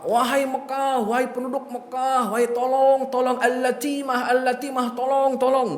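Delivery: imitated high-pitched wailing appeal; mock crying for help. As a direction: raise a singsong alarm, imitating a panicked herald. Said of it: "Kemudian dia teriak-teriak".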